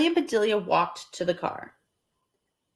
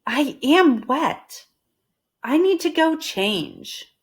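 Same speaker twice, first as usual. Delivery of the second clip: slow, higher plain statement; Amelia Bedelia voiced; 6 semitones higher, slower than usual. Amelia Bedelia telling the Rogers she is wet, slow. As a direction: state it slowly. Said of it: "said Amelia Bedelia".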